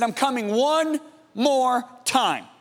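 slow, solemn pronouncement; slow and deliberate, as a divine announcement; Holy Spirit. Retold or imitated. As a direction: draw out each word with weight. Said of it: "the Holy Spirit said to me".

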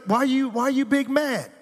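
God playfully mocking Cain, hammed-up voice. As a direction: tease him in a high, mocking tone. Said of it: "'Cause he's like".

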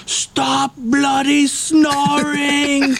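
high, slow mocking voice of the guy; imitating a grumpy bunkmate, drawn out; annoyed roommate. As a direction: drag it out like a whiny stranger. Angry roommate shaking the bed.

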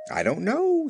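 high-pitched modest demurral; mock modesty. A narrator's reading. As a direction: play little Dixie being modest. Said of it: "said Dixie modestly".

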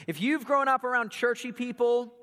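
normal talking voice

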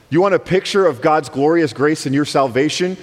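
ordinary talk

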